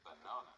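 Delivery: "Banana" with weak uh in first and last syllables, long AH in the middle; schwa in unstressed syllables. Shown as a demonstration. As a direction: say Banana as buh-NAH-nuh. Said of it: "the other two A's have schwa sounds".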